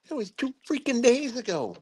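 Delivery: squeezed-high exasperated repeat; retold outrage. Commander repeating his reproach to lieutenant, exasperated. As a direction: act out scolding, pitch sky-high then falling.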